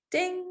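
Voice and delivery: high-pitched sung-out ding; imitated elevator bell. Guide sounding the elevator as doors close, imitated bell. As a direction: chime like an elevator arriving.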